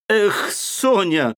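a slow, drawn-out, exasperated Эх, Соня pitched high; irritated cry. Acted. Raskolnikov crying out irritably at Sonya. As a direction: cry out at her in irritation, stretching it. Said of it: "вскрикнул он раздражительно".

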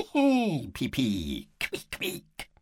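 very high piping squeaks; squeaking mouse. Acted.

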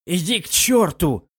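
embarrassed, annoyed snap; swearing. A narrator's reading. Murong Qiu swears at her teasing friend, embarrassed. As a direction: curse at her, flustered. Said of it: "выругалась".

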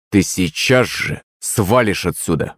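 ordinary delivery, nothing marked

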